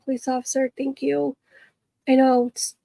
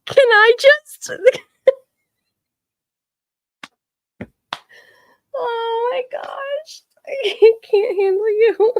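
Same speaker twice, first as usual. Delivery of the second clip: helpless laughter; 11 semitones higher, 6 dB louder, slower than usual. Real, not acted.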